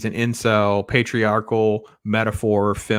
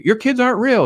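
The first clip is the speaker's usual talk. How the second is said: high, louder mock outburst; imitating a furious wife's retort; indignant retort; 12 semitones higher, 6 dB louder than usual. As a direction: fire it back, pitched up and loud. Alice retorting in the argument.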